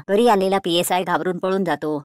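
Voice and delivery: ordinary delivery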